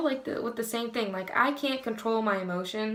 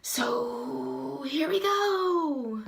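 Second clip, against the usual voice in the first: slow, drawn-out, high and upbeat; excited kickoff; 4 semitones higher, much slower than usual. Real, not acted. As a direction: stretch it out like a big start. Kicking off the list.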